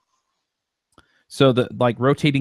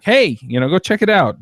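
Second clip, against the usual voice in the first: animated ad read; 8 semitones higher, 4 dB louder than usual. Shown as a demonstration. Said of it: "be a little more animated".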